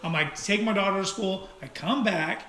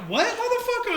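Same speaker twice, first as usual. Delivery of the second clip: retold yell. Driver retelling his answer to a yelling stranger, mock yell. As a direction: yelp it as a retold challenge. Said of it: "he starts yelling at me".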